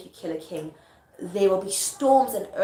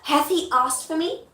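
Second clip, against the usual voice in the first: slow, raised-pitch theatrical question; anxious-sounding stage query; Macbeth; 7 semitones higher than usual. Acted. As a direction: ask it slowly as a stage line. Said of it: "And Macbeth goes".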